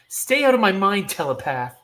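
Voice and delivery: angry defiant cry; defiant shout. Acted. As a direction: yell it, high and loud.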